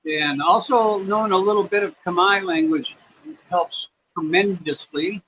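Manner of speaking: normal talking voice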